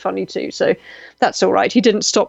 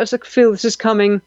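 ordinary delivery

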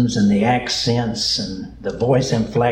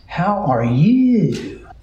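Southern lady's drawn-out, sing-song greeting, much higher and slow; Southern lady drawl; 6 semitones higher, much slower than usual. Retold or imitated. Preacher imitating Southern ladies greeting folks, slow high Southern drawl. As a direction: stretch it out like a Southern lady asking after you. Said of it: "especially the ladies, when they ask".

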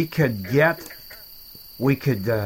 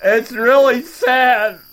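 mock crying; 10 semitones higher, 4 dB louder than usual. Mock grief, quitting the show.

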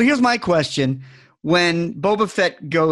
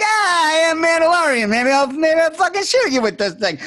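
put-on Bill Burr Boston accent; 8 semitones higher than usual. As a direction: do a hammed-up Boston accent, a tough Mandalorian. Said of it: "He doesn't even drop his Boston accent".

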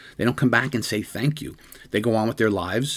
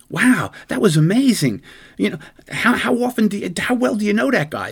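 amazed dispatcher; 10 semitones higher than usual. Retold or imitated.

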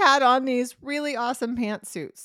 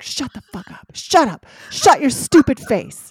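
seething, building to a loud snap; through gritted teeth; 5 semitones higher, 11 dB louder than usual. Retold or imitated. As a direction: spit it out through clenched teeth. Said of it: "my teeth are grinding".

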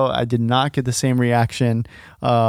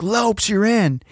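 high-pitched imitation of a yelling coach; coach's yell; 8 semitones higher, slower than usual. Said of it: "I hear him yell".

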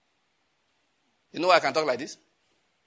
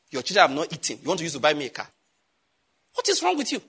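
indignant rebuke. Acted. Pastor rebuking the hungry member's offer, indignant rise.

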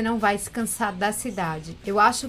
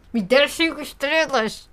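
playful shout; 5 semitones higher, 6 dB louder than usual. Pretend. Joking demand for ratings.

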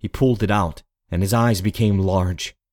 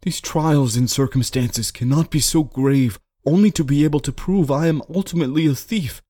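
higher-pitched, troubled soliloquy; anguished reasoning; 6 semitones higher than usual. A narrator's reading. Man of honor wrestles with becoming a thief, anguished. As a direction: plead with your own conscience.

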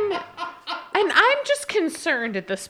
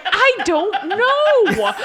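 very slow, louder, breaking into laughter; laughing; 7 semitones higher, 5 dB louder, much slower than usual. Retold or imitated. Retelling answering the confused husband, slow and laughing. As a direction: drag it out through a laugh. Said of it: "And I'm like".

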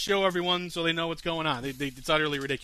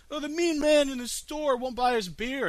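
put-on high whiny child voice; mock crying kids asking for beer, high pitched; 9 semitones higher than usual. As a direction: mimic crying kids begging. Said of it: "they're like".

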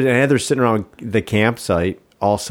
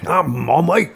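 higher, bad put-on Scottish accent; 7 semitones higher, slower than usual. Answering a friend's dare to say mommy, in a bad Scottish accent. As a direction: give a clumsy Scottish try at the word. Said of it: "my accent sucks".

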